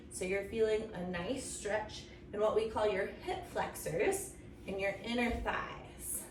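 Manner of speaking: usual speaking voice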